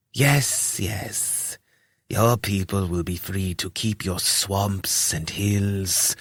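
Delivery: a hushed lisping cultist; lisping robed priest. Acted. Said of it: "spoke with something of a lisp".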